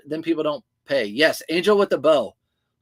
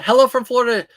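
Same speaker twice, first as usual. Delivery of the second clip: ordinary delivery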